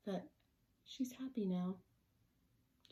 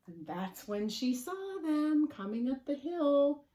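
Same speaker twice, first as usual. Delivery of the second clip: louder, higher storyteller build-up; excited reveal; 7 semitones higher, 9 dB louder, slower than usual. A narrator's reading. Big reveal in the picture book.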